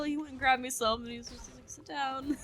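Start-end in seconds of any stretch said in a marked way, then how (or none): 1.7-2.4 higher, gingerly coaxing; cautious, tentative mock instruction; defusing a bomb. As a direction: imitate a dad handling someone very carefully. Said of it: "Like, he was trying to defuse a bomb".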